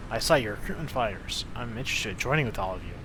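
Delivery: soft reporter voice. Acted.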